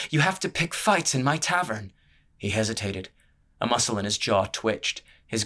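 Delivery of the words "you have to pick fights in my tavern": raised and higher, angry reproach; near shouting. A narrator's reading. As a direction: scold him almost at a shout. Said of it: "She was practically shouting".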